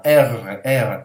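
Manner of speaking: "R" with an attempt at a trilled r; attempted rolled R. Shown as a demonstration. Teacher struggles to roll the Russian R, effortful trill. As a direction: try to roll the "R". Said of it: "j'ai du mal à rouler".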